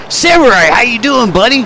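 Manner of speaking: Samurai called out very high and loud; excited hype call. Real, not acted. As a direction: shout the Samurai greeting. Greeting guest with a call.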